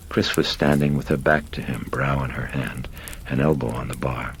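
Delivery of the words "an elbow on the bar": ordinary delivery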